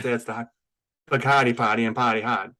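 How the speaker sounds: thick Boston accent with dropped r's; Boston accent trigger sentence. Shown as a demonstration. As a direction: recite it as a Boston accent warm-up. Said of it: "The Boston accent trigger sentence is".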